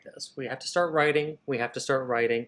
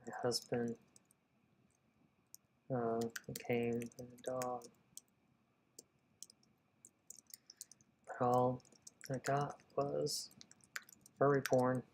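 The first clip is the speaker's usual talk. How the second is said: put-on light country accent; 10 dB quieter than usual. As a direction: read the widow's lines with a light country accent. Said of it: "I just gave her a country accent".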